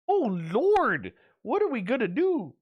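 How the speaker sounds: loud take of a mock churchy lament; mock fretting granny. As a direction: throw up your hands like a scandalized grandma. Mimicking Gail's shocked reaction.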